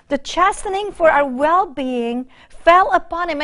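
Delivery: scripture reading. Acted. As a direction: proclaim the verses to the congregation. Reads Isaiah in preaching voice.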